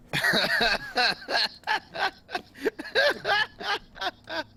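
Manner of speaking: long high-pitched laughing fit; helpless high giggling that runs on. Real, not acted. Laughing at the threat.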